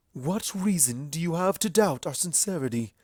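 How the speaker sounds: a lightly voiced royal challenge; Luna voice. A narrator's reading. Luna challenging Everfree across the pool, regal.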